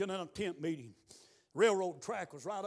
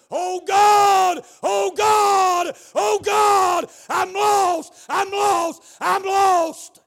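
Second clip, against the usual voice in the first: full-voiced, high imitated screaming; retold scream; 11 semitones higher, 16 dB louder, much slower than usual. Preacher acting out a woman screaming at the altar, retold scream. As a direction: scream each short cry in desperation. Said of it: "She was screaming".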